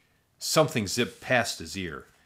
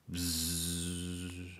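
soft buzz imitation; 3 semitones lower, 12 dB quieter than usual.

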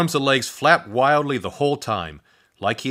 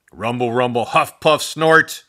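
charging noises; 2 semitones higher, 7 dB louder than usual. A narrator's reading.

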